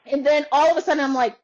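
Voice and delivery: normal talking voice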